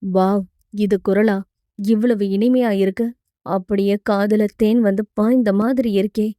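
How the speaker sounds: low-voiced hero marvelling to himself; hero, charmed. (கதாநாயகனின் தாழ்ந்த, மெய்சிலிர்த்த மனக்குரல்)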